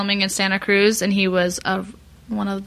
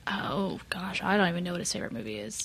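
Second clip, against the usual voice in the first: mumbled; 2 semitones lower, 12 dB quieter than usual. Real, not acted. Flustered, trailing off.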